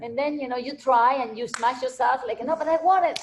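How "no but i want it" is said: high, whining "but I want to"; whiny child. Pretend. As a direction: plead like a stubborn kid, higher. Soul whining back at God.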